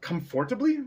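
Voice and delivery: usual speaking voice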